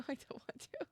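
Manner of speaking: small, high frightened plea; scared whimper. Real, not acted. Playing a scary game, a frightened whimper. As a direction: beg not to go on. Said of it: "I'm scared".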